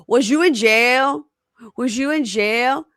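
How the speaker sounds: high, drawn-out retold interrogation; pointed questioning. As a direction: imitate a nosy caller, stretching the question upward.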